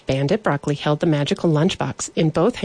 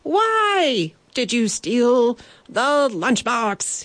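slow, high, drawn-out demand; higher-pitched repeat; 7 semitones higher, slower than usual. Acted. Knight repeats his demand to the bandit, slow and high-pitched. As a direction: stretch out "why" and "the" in a high voice. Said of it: "he repeated, slightly higher pitched".

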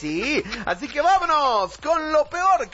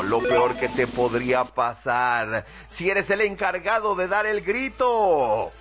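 ordinary delivery

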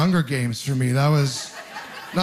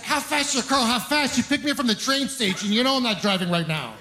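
put-on higher child voice; mimicking the kid; 6 semitones higher than usual. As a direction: play the blunt little kid. Voicing the kid's question.

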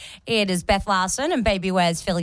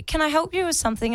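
polite homeowner; 7 semitones higher, faster than usual. Retold or imitated. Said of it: "she said".

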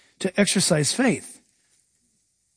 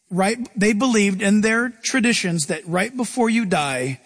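ordinary talk, nothing marked

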